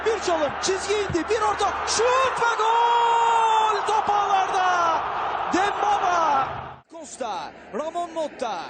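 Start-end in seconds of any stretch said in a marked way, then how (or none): 1.3-6.5 drawn-out loud goal call; screaming football commentator. Real, not acted. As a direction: stretch the goal call and shout it.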